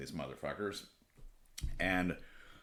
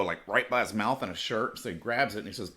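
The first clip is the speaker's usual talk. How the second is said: ordinary delivery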